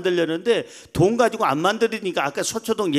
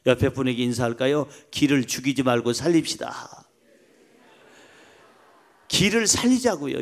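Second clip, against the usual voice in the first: normal talking voice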